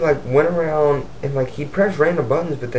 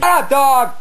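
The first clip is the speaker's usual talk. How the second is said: abrupt, high-pitched shout; real annoyed yell; 5 dB louder, slower than usual. Real, not acted. Storyteller yells at the dog mid-story, annoyed yell. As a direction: cut yourself off to scream at the dog.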